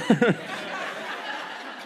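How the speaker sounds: breathy real laugh at his own joke. Real, not acted. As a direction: let a helpless laugh out.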